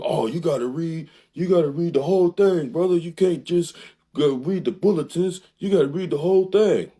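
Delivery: high, loud mock voice of a critic. Mimicking viewers who tell him to read it all, high and loud. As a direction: squeak it out like a scolding know-it-all. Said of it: "I know what y'all gonna do".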